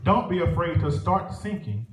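ordinary talk

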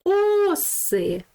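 "Оси" with О high and drawn out; stress on О in Оси. Shown as a demonstration. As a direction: stretch and lift the first vowel of "Оси". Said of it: "Наголос буде на букву О". (високий протяжний наголос на О у слові «Оси»)

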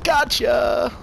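high, loud triumphant cry; excited gotcha. Real, not acted. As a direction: celebrate the kill. Scoring a kill.

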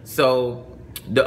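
usual speaking voice

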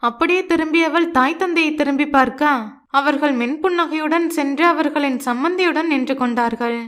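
usual speaking voice

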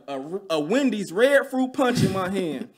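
ordinary talk

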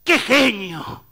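high, loud, slow gush of praise; admiring exclamation. Acted. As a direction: gush like an awestruck fan. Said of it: "uno allá en el fondo que dice".

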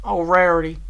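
high, loud lament; distressed. A narrator's reading. Applejack over her unconscious friend, lamenting. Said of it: "Applejack muttered".

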